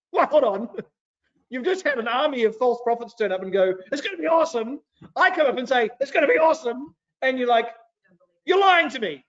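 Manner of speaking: high-pitched, put-on incredulous objection; mock outrage, pitched well up; comic indignant protest. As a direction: protest the unfairness in a hammed-up voice. Micaiah acted, protesting to the king.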